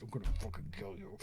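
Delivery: hushed, quick mock threat through laughter; half-laugh a whispered threat. Brother furious at being set up, hushed laughing threat.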